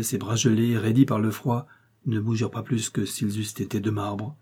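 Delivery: usual speaking voice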